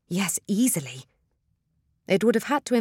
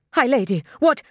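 higher, louder, taken aback; startled protest; 7 semitones higher, 6 dB louder than usual. A narrator's reading. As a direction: blurt it out in alarmed confusion. Startled protest while being pulled away.

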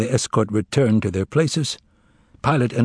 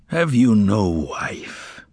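depressed; much slower than usual. A narrator's reading. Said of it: "sounding somehow depressed".

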